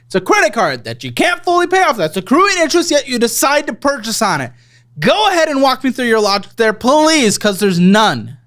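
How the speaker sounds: high-pitched, exasperated scolding; sarcastic, exasperated demand for an explanation. Real, not acted. Host scolds guest for credit spending.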